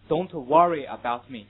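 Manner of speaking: British "Don't worry about me" with an open vowel in worry; British English. Shown as a demonstration. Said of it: "这是英国人的读法". (英式发音读worry)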